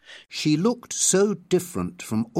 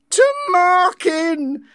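high, slow, loud cry of his name; joyful cry; 5 dB louder, much slower than usual. Acted. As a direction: call out with desperate joy. Linda runs to embrace him.

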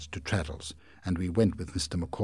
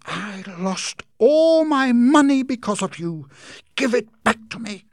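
angry outcry; 11 dB louder than usual. Acted. Aunt seizing Heep by the neck, high and furious. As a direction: blame him to his face. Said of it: "she cried".

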